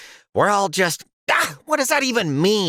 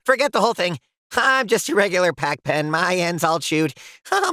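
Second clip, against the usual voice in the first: ordinary delivery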